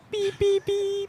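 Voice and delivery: high beeping robot noises; squeaky beeps like a toy robot. Pretend. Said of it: "devolving to robot sounds".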